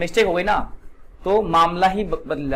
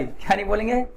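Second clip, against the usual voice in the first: usual speaking voice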